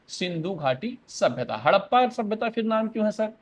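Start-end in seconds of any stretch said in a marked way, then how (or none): 1.6-3.3 a lightly voiced student asking sir, a bit higher; curious student. Teacher voicing a pupil who asks him the other name, mildly curious. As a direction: play a pupil putting up a question.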